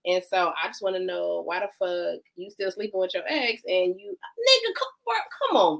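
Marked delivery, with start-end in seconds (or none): 4.0-5.8 high-pitched mock pleading; exasperated protest. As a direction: cut in with a high, impatient whine. Acted exasperated plea to boyfriend.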